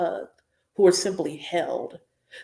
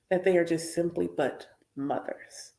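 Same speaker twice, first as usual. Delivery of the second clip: whispered; 2 semitones lower, 6 dB quieter than usual. Real, not acted. Soft closing point.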